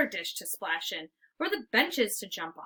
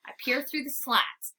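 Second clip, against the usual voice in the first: plain reading voice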